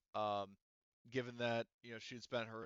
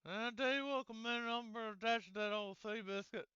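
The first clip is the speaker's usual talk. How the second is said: much higher, put-on voice of the pilot, a little louder; pilot Mike's voice; 12 semitones higher, 4 dB louder than usual. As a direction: play Mike the pilot in a high voice, fond of his ship. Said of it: "he says".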